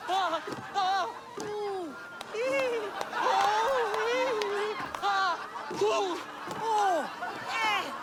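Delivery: hammed-up high cries of pain, one after another; stage death cries. Actor on stage being murdered by conspirators, hammed-up high yelps. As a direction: wail and yelp like an actor being stabbed.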